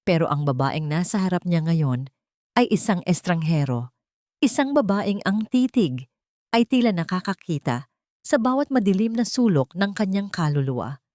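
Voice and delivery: plain reading voice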